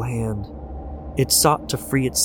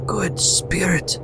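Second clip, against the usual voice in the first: agonized plea; 8 semitones higher, slower than usual. A narrator's reading. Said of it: "In his agony".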